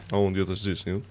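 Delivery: usual speaking voice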